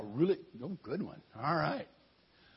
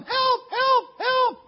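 shrill high yells of help, much louder; hammed-up damsel yelling help, high and loud; mock cry for help; 9 dB louder, much slower than usual. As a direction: scream for help like a faker. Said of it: "started yelling".

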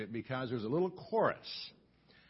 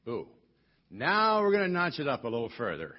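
ordinary talk, nothing marked

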